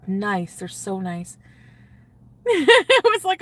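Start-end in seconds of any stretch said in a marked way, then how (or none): 2.4-3.4 giddy squeal. Real, not acted. Excited retelling of date.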